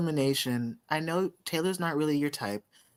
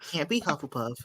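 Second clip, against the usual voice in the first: ordinary delivery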